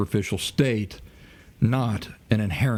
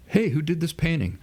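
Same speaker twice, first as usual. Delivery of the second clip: higher-pitched casual query; mock curious questioner; 7 semitones higher than usual. Speaker imitates someone asking about a painting, mock curious. Acted question in artistry analogy.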